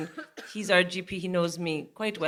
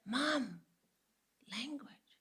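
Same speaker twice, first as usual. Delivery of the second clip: very soft, higher son's voice; quiet reproach; 5 semitones higher, 13 dB quieter, much slower than usual. Retold or imitated. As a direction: softly chide mom, pausing between words. Son primly reproves swearing mother.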